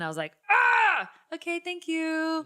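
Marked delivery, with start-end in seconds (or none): 0.5-1.1 loud, high "ah" of dismay; short high wail, acted; mock anguished cry. Retold reaction to bad news. 1.3-2.4 high, slow, strained thanks; drawn-out high mock-polite sign-off; forced politeness. Acted polite reply after disappointment.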